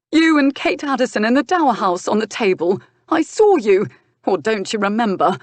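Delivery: high, loud accusing rant; angry accusation. Acted. Nephew accuses uncle of the tryst, high angry rant. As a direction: spit the accusation up at him.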